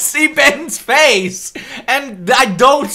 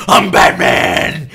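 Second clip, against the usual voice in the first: raspy whispered I'm Batman; slow gruff growl of a hero; a hint of gravel Batman; mock Batman voice; 6 semitones lower, 4 dB louder, slower than usual. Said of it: "the first time Batman goes".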